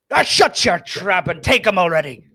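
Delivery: loud, high gruff snap; gruff captain. Acted. Captain snapping at the fisherman, loud gruff order. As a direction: bark it at the fisherman. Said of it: "And the captain goes".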